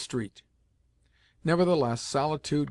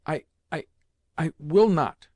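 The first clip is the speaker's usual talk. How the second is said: halting, rising to a louder outburst; gasped, tearful refusal; 4 semitones higher, 5 dB louder than usual. A narrator's reading. Boy refusing his grandmother through choking tears, gasping. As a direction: stammer it out, then gasp the refusal. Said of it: "I gasped".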